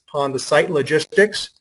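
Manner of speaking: usual speaking voice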